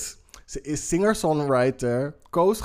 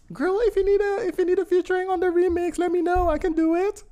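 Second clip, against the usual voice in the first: put-on very high, sassy girl voice; high-pitched diva voice. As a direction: squeal it like an eager diva. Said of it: "Die heeft zichzelf aangeboden van".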